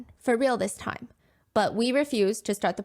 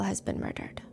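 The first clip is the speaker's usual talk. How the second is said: hushed, breathless reveal; whisper; 2 semitones lower, 8 dB quieter than usual. A narrator's reading. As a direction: drop to a whisper. Dramatic news reveal.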